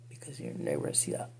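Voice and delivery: ordinary delivery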